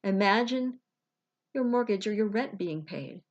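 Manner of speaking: unhurried meditation voice with long pauses; guided visualization. Acted. Life coach guiding listeners to release money worries, guided meditation.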